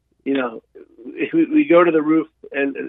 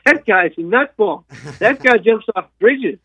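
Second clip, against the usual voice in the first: much higher, slow, scoffing voice for the cousin; mocking the cousin; 8 semitones higher, slower than usual. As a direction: squeak out the cousin's scorn as a joke. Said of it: "And he goes".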